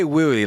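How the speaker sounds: put-on "wooey" as a little child's slurred "really"; imitated speech impediment. Showing how the coworker talked, "wooey" in baby talk. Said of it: "but he would say".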